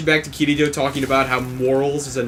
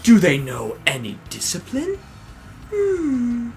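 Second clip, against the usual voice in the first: smug noble; 6 semitones higher, 3 dB quieter, slower than usual. Retold or imitated. Smug noble mocking Yujiro.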